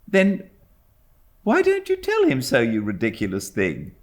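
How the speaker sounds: put-on young mother. A narrator's reading. Mother teasing her servant, a high mock-scolding voice.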